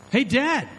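put-on high, loud, eager kid voice; a teenager cheekily quizzing his dad; hammed-up kid asking dad; curious kid. Teen son asks father.

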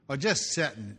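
ordinary talk

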